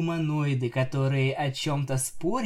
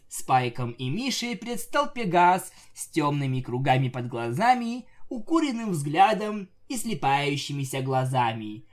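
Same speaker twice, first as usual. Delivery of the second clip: ordinary delivery, nothing marked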